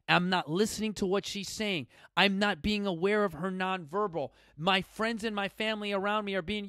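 normal talking voice

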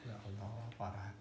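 low, soft sigh of despair; hushed, low exasperation; quiet dismay. Real, not acted. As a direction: mutter it low, giving up on them. Despairing at the wrong answer.

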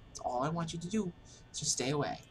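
usual speaking voice

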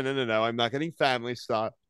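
ordinary talk, nothing marked

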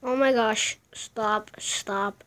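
ordinary talk, nothing marked

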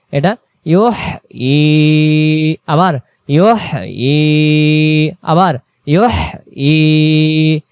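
ফিহা drawn out very slowly, vowels held long; slow recited pronunciation. Shown as a demonstration. (ফিহা টেনে তিলাওয়াত)

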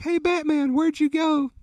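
high, light Robin voice; voicing Robin. Retelling the Robin sketch on the back, high playful Robin voice. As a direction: call out like a lost sidekick. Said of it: "it's like".

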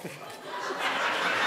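unvoiced laugh breaking out; breathless laugh. Real, not acted. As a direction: crack up, silently.

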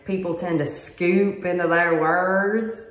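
slippery Southern accent, sliding between words. Shown as a demonstration. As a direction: scoop into the words with a Southern drawl. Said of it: "the southern accent's very slippery and tends to slide around".

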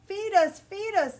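high, loud, demanding chick cry; screeching baby birds. A narrator's reading. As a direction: screech like hungry nestlings. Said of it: "the little ones screech".

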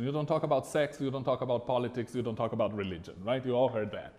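ordinary talk, nothing marked

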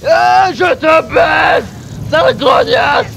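high, full-volume battle yelling; raging, frantic shouts; furious panicked shouting. Real, not acted. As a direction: scream insults in the heat of a fight. Fighting the boss, insulting it.

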